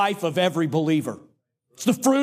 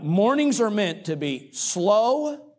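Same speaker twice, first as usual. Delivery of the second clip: normal talking voice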